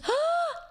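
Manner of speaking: sharp high mock gasp; big gasp, mimicking someone freaking out; freaked-out gasp. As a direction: imitate a starstruck fan's gasp. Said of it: "And she freaks out".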